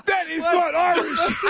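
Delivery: high, slow, laughing protest; incredulous. Real, not acted. Host rejecting the Irish clip, laughing and incredulous. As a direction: stretch each word high in amused disbelief.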